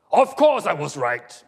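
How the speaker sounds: loud, slow, emphatic comeback; booming self-assurance; indignant retort. Acted. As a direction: insist on it with full force. God retorts to Nichols in play.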